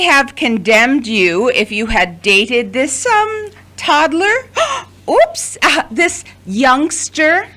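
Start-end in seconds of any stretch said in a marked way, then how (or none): 2.7-7.5 high, sly mock correction; mock slip. Mother teasing son about his young girlfriend, high and sly. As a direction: needle him with a fake slip.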